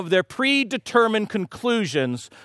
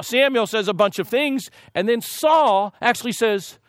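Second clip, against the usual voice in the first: usual speaking voice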